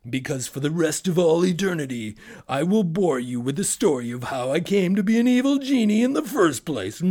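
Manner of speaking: high, loud menacing genie; evil genie voice. Acted. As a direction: threaten like a boastful genie. Genie threatens narrator.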